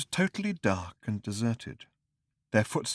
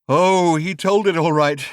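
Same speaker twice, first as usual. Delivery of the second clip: high, loud savage retort; loud, savage bitterness; 7 semitones higher, 9 dB louder than usual. Acted. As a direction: spit it out bitterly. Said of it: "said the man savagely".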